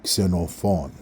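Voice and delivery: Xenophon the Greek way, KS start, stress on the final syllable; Greek pronunciation. Shown as a demonstration. Teacher finishing the Greek pronunciation of xenophon. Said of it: "That's how you pronounce my name in Greek properly".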